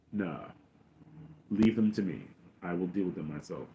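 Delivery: low, soft, unhurried; Vader impression. Vader claims the shuttle for himself, low and quiet. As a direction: dismiss it quietly in a dropped voice. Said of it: "and Vader's like".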